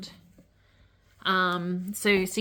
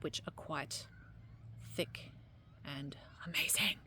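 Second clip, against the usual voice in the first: whispering; 4 semitones lower, 11 dB quieter than usual. Acted. Hushed awe over crystals.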